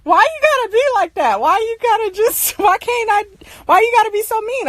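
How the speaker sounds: high, whiny mock sister; imitating sister. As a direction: mimic a sister pleading in a high voice. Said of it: "She just like".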